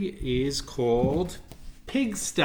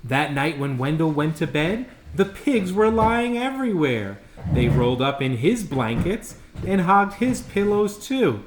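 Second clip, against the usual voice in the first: put-on lively read-aloud for kids; animated storyteller voice; storybook reading; 3 semitones higher, 6 dB louder than usual. A narrator's reading. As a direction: tell it like a bedtime picture book. Reads picture book aloud.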